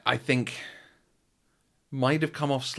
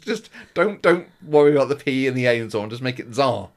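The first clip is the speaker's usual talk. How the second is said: shouting at the TV; 5 semitones higher, 8 dB louder than usual. Retold or imitated. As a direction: yell advice at a contestant on TV. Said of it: "shouts at the T V".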